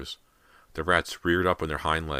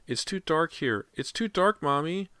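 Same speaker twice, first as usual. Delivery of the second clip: put-on scared child; 12 semitones higher, 3 dB louder than usual. A narrator's reading. Child voice pleading.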